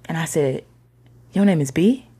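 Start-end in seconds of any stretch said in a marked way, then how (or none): 1.3-2.1 loud, higher, disbelieving question; stunned question. Retold or imitated. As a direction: ask it as realization suddenly hits. Stunned realization about the name.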